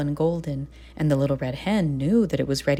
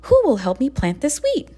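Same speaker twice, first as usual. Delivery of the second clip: high, louder hopeful question; eager little hen; 10 semitones higher, 5 dB louder than usual. A narrator's reading. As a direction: ask the barnyard brightly for help. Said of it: "she clucked".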